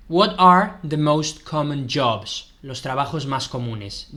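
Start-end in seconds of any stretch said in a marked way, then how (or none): 0.1-2.5 slow, clear question in English; slow English heading. Shown as a demonstration. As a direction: announce the topic slowly. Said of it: "teacher reads English heading". (pregunta en inglés, lenta y clara)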